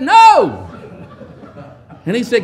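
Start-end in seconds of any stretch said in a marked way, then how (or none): 0.0-0.7 loud blunt no. Acted. Disciples' blunt answer repeated.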